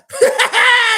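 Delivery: high loud burst of laughter; big laugh. Real, not acted. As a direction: let out a big cackle.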